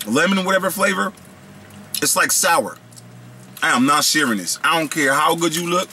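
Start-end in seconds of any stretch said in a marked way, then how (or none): none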